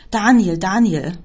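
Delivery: loud, drawn-out outburst; slow, loud exclamation; bursting out. A narrator's reading. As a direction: blurt the name out in exasperation. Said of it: "brach sie heraus".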